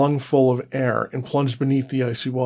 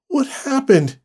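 dazed; 11 semitones higher than usual. Acted. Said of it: "she asked".

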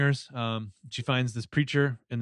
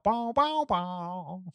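sung high twang, like a spaghetti-western guitar; western twang sound; 6 semitones higher, much slower than usual. Retold or imitated. Host adds a mock western music sting for Faith's entrance. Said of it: "the Clint Eastwood Man of No Name".

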